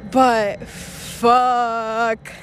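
leaning hard on "fuck", a heavy emphatic curse. Real, not acted. Vlogger cursing about broken fantasies, emphatic "fuck". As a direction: really hit "fuck" as the word that sums it up. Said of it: "I can't emphasize on the word fuck".

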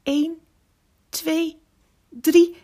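high countdown, building to loud; suspenseful count ending on a burst. A narrator's reading. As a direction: count with pauses, gearing up for a big effort. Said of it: "telde tot drie".

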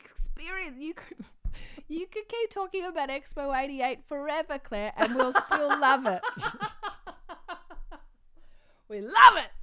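high, loud giggling through the words; laughing playful mock praise; laughing teasing. Real, not acted. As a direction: tease her while cracking up. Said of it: "Another dig at my material".

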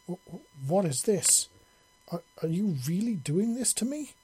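breathless, high, stammering gasps; gasping with pleasure. Acted. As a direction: gasp it out, overwhelmed with pleasure. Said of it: "she gasped in a pleasure-soaked voice".

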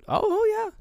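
very high, loud, overeager mock agreement; obvious lying. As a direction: imitate a badly faked "of course". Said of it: "he's clearly lying".

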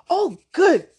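put-on bursting with boyish joy; happily. A narrator's reading. Thomas delighted to write his fish, happy. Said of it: "Thomas said happily".

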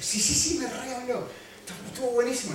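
"Sí, sí, sí" jumping high, thrilled; excited wife. Retold or imitated. Wife telling her husband, bubbling with excitement. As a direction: gush like she just heard great news. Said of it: "estaba re contenta".

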